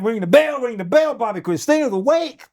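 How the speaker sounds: high, excited announcing; retold excitement. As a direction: spread the great news down the hall. People in hall spreading the news.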